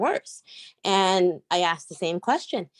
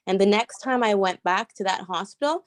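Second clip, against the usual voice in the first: ordinary talk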